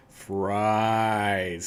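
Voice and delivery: drawn-out, patronising single word; slow-motion pronunciation for non-speakers. Acted. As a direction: stretch the word right out, as if teaching it. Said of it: "saying, 'Fries,' slowly".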